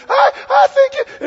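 very high, loud strained coach voice; squeaky intense coach. Retold or imitated. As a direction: push the pitch way up like he's losing it. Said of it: "But he'd get so high".

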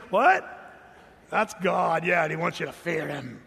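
loud cartoon-villain bark of a line; hammed-up Skeletor shouting down from the mountain; mock cartoon villain. Preacher mocks the harsh reading with a loud villain yell. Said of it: "like He-Man and Skeletor".

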